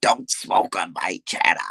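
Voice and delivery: slow, off-voice mock of the old man. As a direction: mimic the grumpy old man. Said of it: "that old man talk about some".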